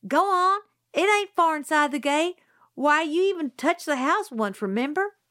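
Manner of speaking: high, teasing dare; taunt. A narrator's reading. Scout dares Jim to fetch the tire. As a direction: goad him into going.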